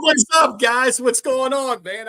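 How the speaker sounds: big, loud, high hype hello; excited loud greeting. Real, not acted. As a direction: burst in shouting hello to the hosts. Guest's hyped entrance greeting on show.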